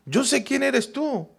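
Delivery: lightly voiced Satan; a hint of a sneering devil; Satan confessing. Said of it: "está confesando Satanás mismo".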